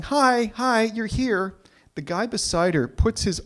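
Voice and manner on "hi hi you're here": higher, slow strained cheerful greeting; a forced bright hello under pressure; relieved cousin greeting. Retold or imitated.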